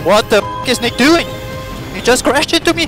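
ordinary talk, nothing marked

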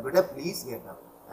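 soft, loving "बेटा please get up"; very tender plea. Shown as a demonstration. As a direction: coax her gently like a loving teacher. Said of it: "ऐसे इतने प्यार से". (बहुत प्यार से, नरम आवाज़ में)

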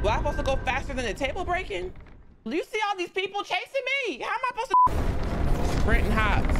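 high, panicked, breathless questions; frantic while running for your life; being chased. Real, not acted. Chased by enemies in game.

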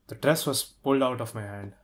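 normal talking voice

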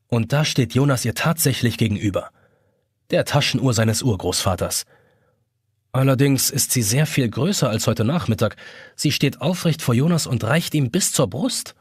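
plain reading voice

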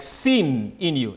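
"seen" stretched slowly, pitched low; stress on seen. Shown as a demonstration. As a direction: draw out "seen" in a low, measured voice. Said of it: "trying to emphasize some words".

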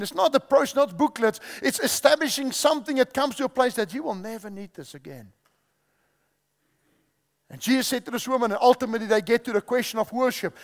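ordinary talk, nothing marked

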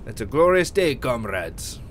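a mock Soviet officer's bad Russian accent. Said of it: "I have a really bad Russian accent".

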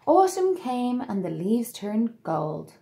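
put-on lively read-aloud to children; storybook narration. A narrator's reading. Narrator telling children the tale, bright and lively. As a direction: read it like bedtime story time.

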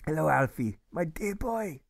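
put-on a kindly old German greeting the boy; German accent. Shopkeeper greets Alfie in a warm German accent. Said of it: "the good German says".

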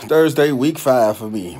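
ordinary delivery

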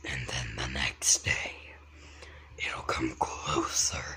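creepy whisper. Acted. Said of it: "Doing that voice".

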